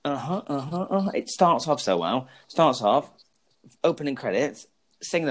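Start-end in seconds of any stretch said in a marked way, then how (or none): none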